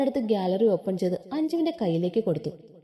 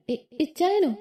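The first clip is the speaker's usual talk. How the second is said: stammering, higher disbelief; stunned chorus; 5 semitones higher, slower than usual. A narrator's reading. Three friends stunned by her news, asking together in higher disbelief. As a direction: gasp it out in shock. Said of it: "മൂന്ന് പേരും ഒരേ സ്വരത്തിൽ അവളോട് ചോദിച്ചു".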